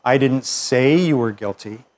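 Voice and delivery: slow, with "say" stretched and stressed. Shown as a demonstration. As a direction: lean hard on the word "say". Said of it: "suggests something different than saying".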